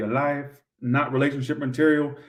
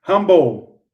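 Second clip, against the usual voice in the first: yelled; 5 semitones higher, 10 dB louder than usual. Real, not acted. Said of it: "I'm yelling and screaming that".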